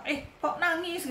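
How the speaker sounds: high-pitched mock taunt; mocking jeer. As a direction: imitate someone teasing a crier. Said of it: "orang suka ngata-ngatain orang".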